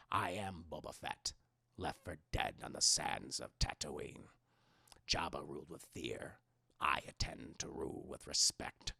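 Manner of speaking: deep, raspy, half-whispered bounty hunter lines, slow; low gravelly menace, near a whisper; gravelly Boba Fett. Shown as a demonstration. Streamer voicing Boba Fett's iconic lines, low and gravelly. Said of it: "my best Boba voice".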